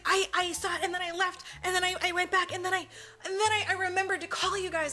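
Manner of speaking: high, stuttering mock alibi; acted nervous rambling. Suspect explaining herself to police, hammed-up nervous stutter. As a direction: stumble through it like a flustered suspect. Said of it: "She's nervous".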